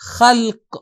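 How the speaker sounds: خلق as a teacher's model Arabic word; careful Arabic pronunciation. Shown as a demonstration. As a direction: pronounce خلق carefully with Arabic kh and final qaf. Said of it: "تلفظ بھی ہم ان الفاظ کا صحیح کرنا سیکھیں". (خلق کا واضح عربی تلفظ)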